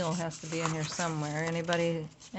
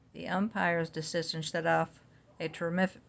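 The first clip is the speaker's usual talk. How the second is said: usual speaking voice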